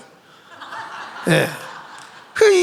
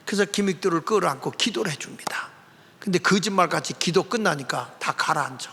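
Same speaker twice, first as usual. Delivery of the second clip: whisper; 4 semitones lower, 6 dB quieter than usual. Acted. Quiet storytelling moment.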